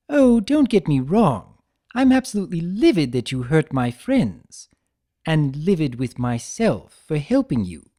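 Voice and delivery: playful trickster Discord. Acted. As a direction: tease him like a smug jester. Discord voiced in the story.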